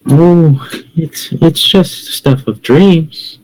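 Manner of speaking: creepy pastry seller. Acted. Demon woman offering her pastries to a passer-by, creepy and slow. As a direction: lift the voice and draw it out enticingly.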